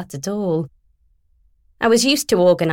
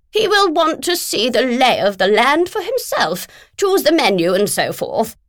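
higher, brisk voice for a pushy agent on the phone; brisk, pushy, higher-pitched businesswoman; bossy literary agent; 6 semitones higher, 3 dB louder than usual. Acted. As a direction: play the bulldozing agent who won't wait. Philomena dictating requirements.

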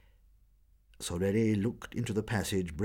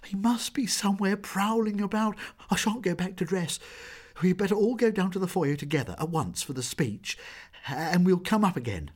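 frightened urging; 10 semitones higher, 4 dB louder than usual. A narrator's reading. Jeanne frightened, urging everyone down.

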